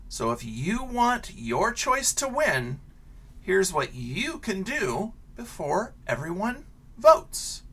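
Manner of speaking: lively picture-book read-aloud; reading to children. Acted. Teacher reading a picture book to her class, animated storytelling.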